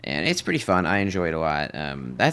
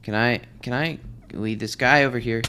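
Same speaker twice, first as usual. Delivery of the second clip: ordinary talk, nothing marked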